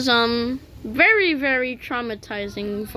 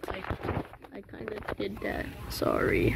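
sheepish whisper; 3 semitones lower, 12 dB quieter, faster than usual. Real, not acted. Sheepish after meme.

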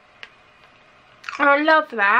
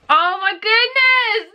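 high gasp, then a slow, higher oh my goodness; 1 delighted gasp; 11 semitones higher, much slower than usual. Real, not acted. Opening a handmade hairband, gasps in real delight. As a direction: gasp and gush over the gift.

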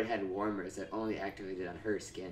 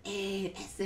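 put-on Mexican accent; 10 semitones higher, 3 dB quieter than usual. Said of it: "can you do a Mexican accent?".

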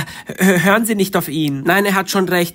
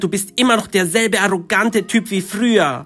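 ordinary talk

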